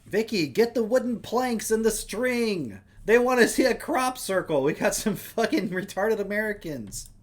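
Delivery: loud, high holler, hammed up; yelling up the street. Shopkeeper summoning friends about the Americans, yelling up the street. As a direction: holler orders to friends far away. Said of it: "yells up the street".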